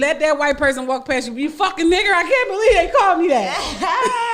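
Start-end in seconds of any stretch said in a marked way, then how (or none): 1.4-4.3 hammed-up outburst, high, loud and breaking into laughter; mock outrage. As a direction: play the outraged reaction to an insult for laughs. Acts out outrage at a slur.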